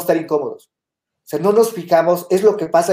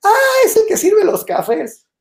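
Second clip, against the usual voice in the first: high, slow, teasing put-down; mocking sister; 4 dB louder than usual. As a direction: mimic the sister's scornful tease, pitched way up. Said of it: "mi hermana se burló y dijo".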